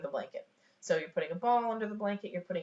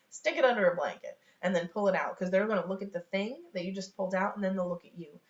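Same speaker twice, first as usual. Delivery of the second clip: ordinary delivery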